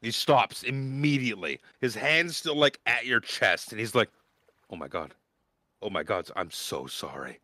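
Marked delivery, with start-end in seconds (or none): 4.7-7.4 low, soft, sudden deflation; mortified apology. Acted. Young man realizes he smeared the knight's chest, quiet mortified apology. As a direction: drop your voice in dawning embarrassment.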